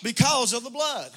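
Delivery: sing-song preaching rising in fervour; preaching at full force. Acted. Preacher rousing the congregation with fervour, full voice.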